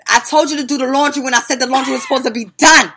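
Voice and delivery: loud, high exasperated scold; strict mom scolding. Retold or imitated. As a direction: lay into the older girls. Mom scolding girls harshly.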